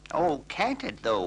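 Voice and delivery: high wailing cat voice. Acted. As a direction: wail it like a miserable old cat. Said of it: "wailed the cat".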